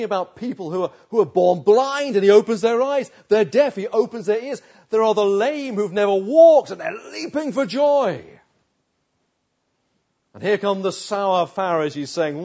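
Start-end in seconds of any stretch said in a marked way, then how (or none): 1.1-8.2 louder, higher preaching climax; rising fervour. Real, not acted. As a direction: build it up with wonder.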